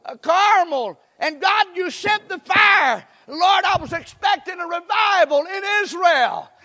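high, loud despairing cry to God; Elijah crying out. Retold or imitated. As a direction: shout the prophet's lament up to heaven. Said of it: "Elijah's saying".

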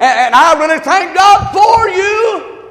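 loud, high, slow cry of thanks; slow, loud, high climax of the sermon; pulpit shout. Real, not acted. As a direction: build to a fervent peak. Preaching at full force.

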